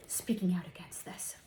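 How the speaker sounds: a hushed, breathy mock-scandalised whisper. Psychic whispering to viewers as if it's a scandal. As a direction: drop it to a secret whisper. Aside while mocking the backlash.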